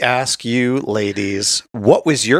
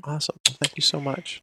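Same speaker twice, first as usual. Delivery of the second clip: emotional; 5 semitones higher, 9 dB quieter than usual. Real, not acted. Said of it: "Billy is emotional".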